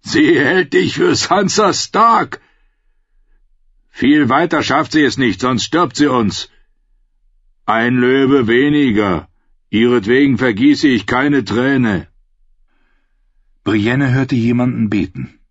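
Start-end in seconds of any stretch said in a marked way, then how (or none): 0.0-2.4 high, loud, slow jeering; an amused outlaw mocking; mocking man. A narrator's reading. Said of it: "Ein Mann lachte". 3.9-12.1 lightly voiced outlaws arguing; gruff outlaws. A narrator's reading. Outlaws talking over prisoner.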